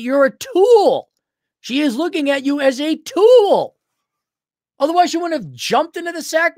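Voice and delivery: high, emphatic berating, drawing out key words; exasperated scolding. Real, not acted. Host berating a caller for being used, exasperated.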